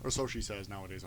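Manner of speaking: usual speaking voice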